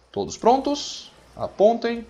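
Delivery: high, stretched-out parade call; officer's drill command. Pretend. Officer readying his soldiers to fire, high drawn-out command. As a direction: call the orders up high to the line.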